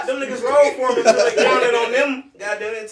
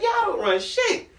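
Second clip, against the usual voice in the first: high, slow, drawn-out retort; hammed-up scoffing defiance; defiant comeback; 13 semitones higher, slower than usual. Storyteller answering a group that claims control, defiant.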